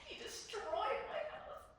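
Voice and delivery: very high, drawn-out whine to parents; acted-out childish protest; complaining child. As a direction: tattle slowly in a high little voice. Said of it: "I complained to my mom and my dad".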